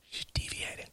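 hushed whisper fading to barely audible; confiding sotto voce whisper. Real, not acted. Drops to whisper, repeating the word.

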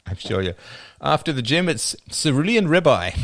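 normal talking voice

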